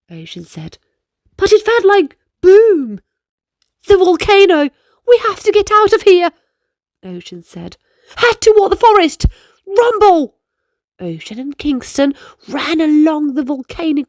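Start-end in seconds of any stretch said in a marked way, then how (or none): 1.4-3.0 put-on alarmed boy. Ocean, loud. 3.9-6.3 loud, fast, higher alarm; boy fleeing a volcano; panicked shout. Acted. Ocean panicked. 8.2-10.4 loud, fast, higher alarm; boy fleeing a volcano; panicked shout. Acted. Ocean panicked.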